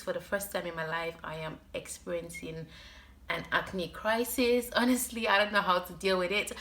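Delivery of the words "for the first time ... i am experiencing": ordinary talk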